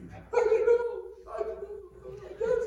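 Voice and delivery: shy big guy shrugging it off, chuckling; delivery man's voice. Retold or imitated.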